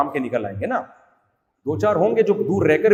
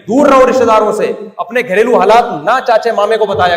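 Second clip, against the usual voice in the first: loud, high scolding warning; stern scolding; 5 semitones higher, 9 dB louder than usual. Real, not acted. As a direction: scold the congregation firmly. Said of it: "اب تو میں ڈانٹنے پر آ جاؤں گا". (ڈانٹتے ہوئے سختی سے)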